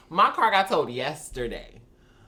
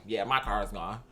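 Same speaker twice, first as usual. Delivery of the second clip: resigned mutter; 9 semitones lower, 7 dB quieter than usual. Retold or imitated. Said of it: "your soul already know".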